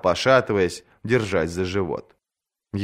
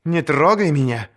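sharp warning; 6 semitones higher than usual. A narrator's reading. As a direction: warn her off sharply. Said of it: "остерегла её Ария".

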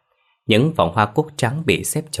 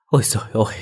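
wailing lament; 3 semitones higher, much faster than usual. A narrator's reading. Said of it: "Tiếng gào khóc của bà mẹ già".

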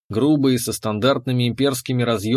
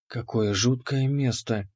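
soft, slow hushed voice; whispered; 6 dB quieter than usual. A narrator's reading. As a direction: breathe it out quietly. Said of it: "прошептала Ани".

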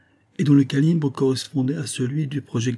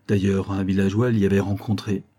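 ordinary delivery, nothing marked

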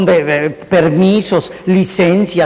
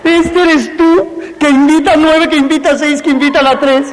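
mock salesman pitch; 8 semitones higher than usual. Mock pitch drawn on the board.

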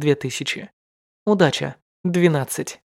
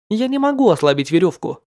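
usual speaking voice

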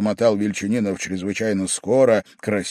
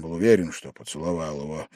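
plain reading voice

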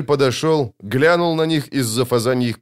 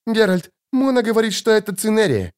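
high alarmed report; high-pitched urgency; urgent cry; 11 semitones higher than usual. A narrator's reading. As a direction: warn in a high voice. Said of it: "крикнула Брайан".

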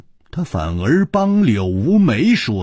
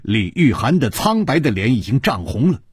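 ordinary delivery

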